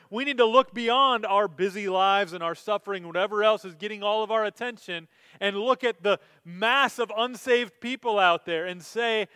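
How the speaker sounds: loud, high, driving sermon delivery; impassioned preacher at full volume; full-force preaching. Acted. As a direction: preach it out with rising fire.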